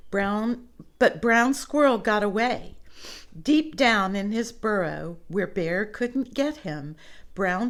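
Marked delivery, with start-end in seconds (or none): none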